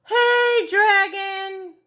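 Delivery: shout. Acted. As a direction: yell right into the dragon's ear. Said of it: "She shouted as loud as she could".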